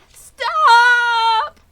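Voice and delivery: very high, very loud scream. Acted. Wilbur cuts off the old sheep, screaming very high and loud. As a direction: scream to cut her off. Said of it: "screamed Wilbur".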